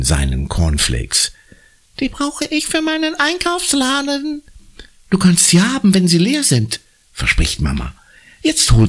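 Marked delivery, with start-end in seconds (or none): 2.0-4.4 put-on very high, small child's voice; little boy voice. A narrator's reading. Jakob antwortet Mama, sehr hohe Kinderstimme. 5.1-6.8 higher mother's voice; lightly voiced mother. A narrator's reading. Mama verspricht Jakob die Gläschen, leicht höhere Mutterstimme.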